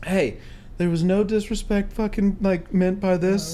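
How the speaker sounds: defuse it gently, spacing out the phrases; staying very calm. Retold or imitated. Son defusing his furious dad on the phone, acted calm. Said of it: "I just stayed so calm".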